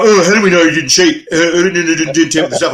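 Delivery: a lightly voiced suspicious critic; mock skeptic. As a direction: parrot the doubters' whine lightly. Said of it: "people go".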